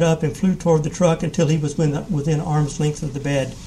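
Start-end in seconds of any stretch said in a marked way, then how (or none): none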